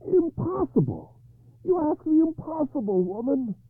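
high, loud cry of refusal; outraged cry. A narrator's reading. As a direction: hurl back the demand in outrage. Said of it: "Then Arthur cried".

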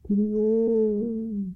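high, very slow fading wail; mournful ghostly cry. A narrator's reading. Ghostly fisherman's cry fading into the west, mournful and slow. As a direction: let it drift away like a ghost. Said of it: "lingered the mournful cry".

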